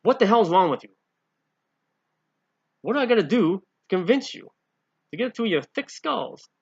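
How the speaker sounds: loud, high, fed-up rant; Jesus losing patience with his disciples, loud and scolding; exasperated scolding. Retold or imitated. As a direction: berate them in modern speak. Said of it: "In modern speak".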